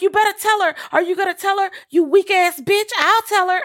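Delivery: high, loud, screeching mother from the house; mother screaming. Retold or imitated. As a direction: shriek it like a furious mother yelling across the house. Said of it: "she was like".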